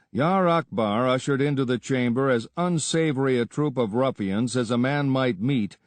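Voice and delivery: usual speaking voice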